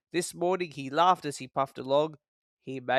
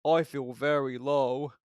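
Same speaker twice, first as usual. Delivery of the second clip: pathetic Gordon; 2 semitones lower, slower than usual. A narrator's reading.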